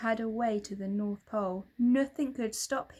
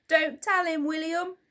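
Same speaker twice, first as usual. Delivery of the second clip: higher, louder Santa voice, face down; mumbled plea; 8 semitones higher, 9 dB louder than usual. A narrator's reading. Santa face down begging William not to tell. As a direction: plead through a mouthful of beard. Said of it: "Santa mumbled".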